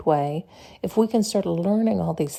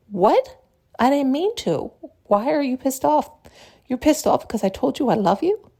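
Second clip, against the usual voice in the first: confused surprise; 6 semitones higher than usual. Acted. Acted partner, confused reply.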